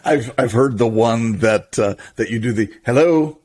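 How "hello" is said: to someone hard of hearing. Retold or imitated. Answering machine joke voice.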